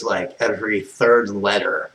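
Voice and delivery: ordinary talk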